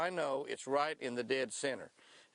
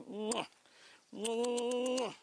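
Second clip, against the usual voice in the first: kiss sound; 8 semitones higher than usual. Real, not acted. Angler kissing his big bass, smacking kisses. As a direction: plant two kisses on the fish.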